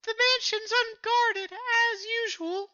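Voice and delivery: high-pitched falsetto belle; Nami, a Southern belle. Acted. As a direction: put on a high, drawling lady's voice. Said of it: "I'll take over Nami".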